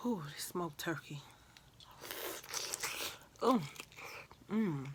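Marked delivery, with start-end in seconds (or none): 0.1-1.2 whispering. Real, not acted. Whispering over food.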